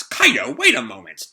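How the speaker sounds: acted outburst, loud on the opening; indignant snap. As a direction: protest like an affronted overlord. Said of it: "Orochi turns to him and snaps".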